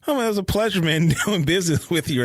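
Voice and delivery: high, singsong quip with a chuckle in it; sarcastic. Retold or imitated. Podcaster retells Anakin taunting the villain he beat, lightly sarcastic. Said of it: "just kinda sarcastic with it".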